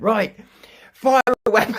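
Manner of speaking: high, loud mock captain yelling; hammed-up shout to attack; manic shouted orders. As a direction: bellow the order like a crazed captain. Barking mad orders at AI.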